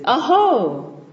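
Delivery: mock awakened cry. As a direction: voice the king's sudden awakening. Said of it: "he says".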